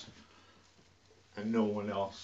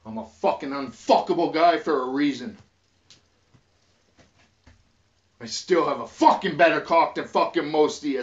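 louder, higher furious bragging; loud angry boast; 4 semitones higher, 7 dB louder than usual. Real, not acted. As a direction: throw the boast in their faces. Boasting rant at mockers.

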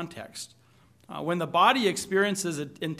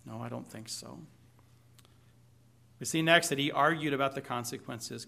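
usual speaking voice

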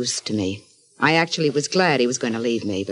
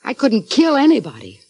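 raised, higher indignant denial; upset protest; 8 semitones higher, 5 dB louder than usual. Acted. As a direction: throw back the accusation, louder and higher. Wife accused of murder, protests.

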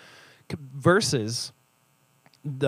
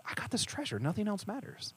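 much higher and softer, a light airy voice; content believer; 9 semitones higher, 10 dB quieter than usual. Retold or imitated. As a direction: float it up, carefree.

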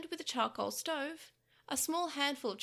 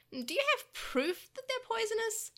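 nervous demon voice; 11 semitones higher than usual. Acted. Pico Devimon stammering defensively.

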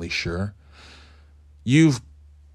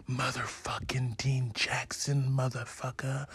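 hushed, breathy whisper trailing to nothing; fading whispered brag; mock whisper; 3 semitones higher, 13 dB quieter than usual. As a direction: whisper it like a secret boast. Host whispers his own name.

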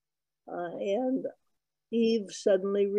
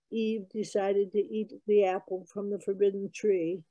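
ordinary talk

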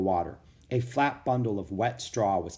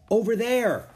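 high, louder exclamation; excited pointing out; 11 semitones higher, 5 dB louder than usual. A narrator's reading. Annie shows Jack and Rose the castle, excitedly. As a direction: call it out eagerly.

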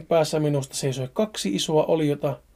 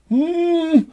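high, louder muffled mmm with no mouth; terrified muffled hum; 13 semitones higher, 6 dB louder than usual. Acted. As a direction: whimper through a sealed mouth. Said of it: "mumisin kauhuissani".

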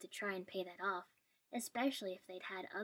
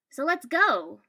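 loud, high rallying cry; eager urging; 7 semitones higher, 16 dB louder, slower than usual. A narrator's reading. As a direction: urge a friend along loudly. Boy eagerly urges friend to come.

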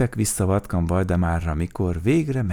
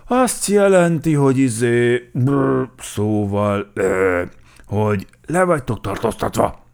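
loud, hesitant fumbling with fillers; bumbling, halting character voice; flustered stammering; 6 semitones higher, 9 dB louder than usual. A narrator's reading. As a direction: stumble toward the explanation, dragging out each filler. Flustered bear stammers out an explanation.

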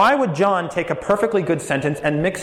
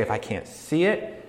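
skeptic; 6 semitones lower, 6 dB quieter than usual. Retold or imitated. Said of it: "And so we say".

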